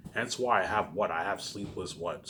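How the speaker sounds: usual speaking voice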